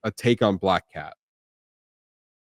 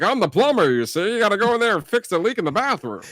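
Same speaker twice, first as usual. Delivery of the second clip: higher, fast hero voice faking a plumber; fake plumber bluff; 9 semitones higher than usual. Retelling the hero's plumber disguise to his co-host. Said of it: "he was like".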